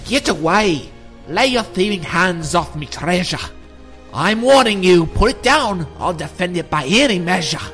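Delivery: angry yelling leprechaun. Acted. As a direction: warn off the thief. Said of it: "the leprechaun yelled out".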